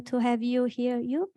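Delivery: ordinary talk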